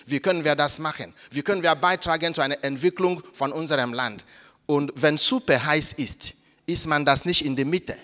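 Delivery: ordinary talk, nothing marked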